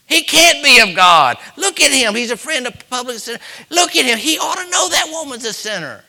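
high, loud outraged accuser; mock ridicule. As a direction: jeer like a scandalized critic pointing him out. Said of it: "did he not take ridicule".

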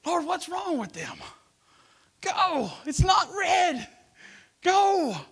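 high shouted mock road rage; frustrated driver. Preacher imitating himself yelling at slow drivers, mock angry. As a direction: yell at the car ahead. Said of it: "I talk to drivers sometimes".

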